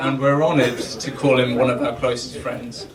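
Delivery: ordinary talk, nothing marked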